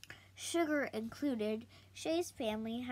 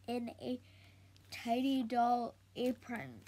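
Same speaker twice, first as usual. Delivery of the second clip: ordinary delivery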